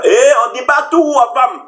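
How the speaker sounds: high, drawn-out exclamation; mock alarmed objection. Warning a man who tells his wife everything, mock alarmed.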